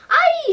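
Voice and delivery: very high, very loud scream of "ai"; loud shocked cry. Acted. Wife finding her husband in the pot, loud shriek. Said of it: "she cried so loud".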